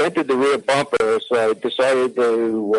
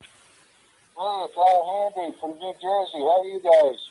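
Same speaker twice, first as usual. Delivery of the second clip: thin phone line voice; radio show caller; 5 semitones higher than usual. Real, not acted.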